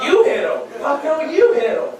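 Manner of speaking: hammed-up squabble, punching the word YOU; acted refusal. Kids in a dodgeball standoff passing the job on, acted refusal. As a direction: stress on YOU, pitched high and loud.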